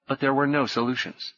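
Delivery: plain reading voice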